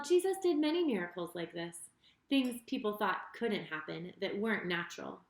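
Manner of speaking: usual speaking voice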